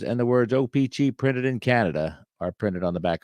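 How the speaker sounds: normal talking voice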